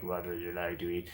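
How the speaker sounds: hushed, low spooky servant murmur; spooky waiter. Acted. Haunted restaurant server greeting diners, lightly spooky. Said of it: "you got spooky servers who are all just like".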